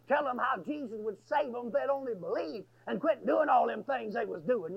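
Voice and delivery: usual speaking voice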